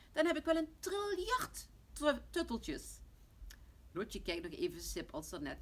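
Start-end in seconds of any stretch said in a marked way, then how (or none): 0.1-3.0 put-on high, loud boyish delight; gleeful child. Tommy dreams of a trillion tutteltjes, excited child voice. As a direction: crow like a thrilled small boy. Delighted boy imagines many toys.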